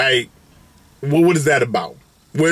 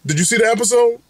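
normal talking voice

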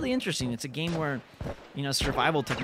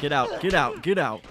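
loud, insistent get out; loud real yelling; repeated shout; 2 semitones higher, 9 dB louder than usual. Real, not acted. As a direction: bark it at the enemy three times. Shouting at enemy.

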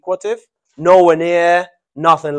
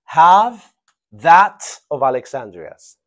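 slow model sentence; 6 dB louder, slower than usual. Shown as a demonstration. Teacher modelling the answer to the class, slow and clear. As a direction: have that of Alexandria's, slow and deliberate, each word spaced out. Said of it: "Very good".

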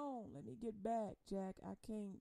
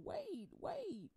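higher, incredulous scolding; a fed-up God calling out his child; high disbelieving rebuke; God's exasperated voice; 5 semitones higher than usual. Acted. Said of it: "I keep going".

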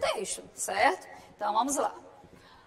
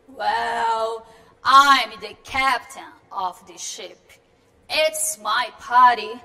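slow, louder, lower man's voice; Tom Gray voice; 3 semitones lower, 8 dB louder, much slower than usual. Acted. Teacher voicing Tom Gray meeting Marianne, slow and low. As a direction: introduce yourself slowly and firmly as the captain.

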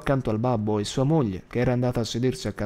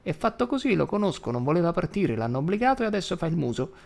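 put-on high, fast old woman grumbling; high fussy grandmother's complaint; grumbling grandma; 5 semitones higher than usual. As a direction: rattle it off like a cross granny. Grandma scolding husband.

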